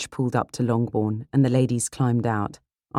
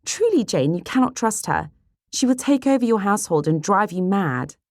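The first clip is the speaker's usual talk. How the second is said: high, emphatic warning; vehement, high-pitched young girl; indignant sister Kitty; 9 semitones higher, 3 dB louder than usual. Acted. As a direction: press the warning home in a lifted voice.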